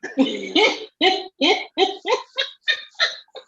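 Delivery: high, drawn-out laughing fit; long laugh. Real, not acted. Listener tickled by the crackers story, long high laugh.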